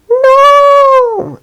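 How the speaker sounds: high, loud shriek of "No!"; scream. Retold or imitated. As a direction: scream it in shock. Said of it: "And I like".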